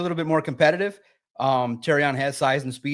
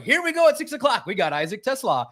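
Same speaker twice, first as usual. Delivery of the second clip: high, hammed-up TV intro; mock the nightly news lead-in; TV announcer voice; 8 semitones higher than usual. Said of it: "It's like".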